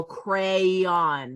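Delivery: crayon drawn out as "cray-on"; sounded-out spelling. Shown as a demonstration. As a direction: say crayon slowly, both syllables, Y sounded. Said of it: "not say the Y".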